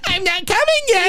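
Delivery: very high taunting squeak; high mock voice. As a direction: squeak it out mockingly. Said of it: "He's like".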